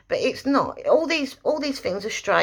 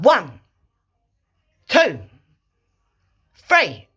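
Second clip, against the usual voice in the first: each number short, sharp and louder; sharp counted cues; 6 dB louder, slower than usual. Shown as a demonstration. Trainer counting cues sharply to her dog. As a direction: snap out the counts one by one. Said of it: "you need to just say one".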